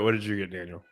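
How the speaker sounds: normal talking voice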